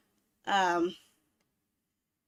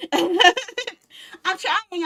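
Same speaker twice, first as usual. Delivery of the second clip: laughing; 8 semitones higher, 6 dB louder than usual. Real, not acted. Laughing at failing to remember.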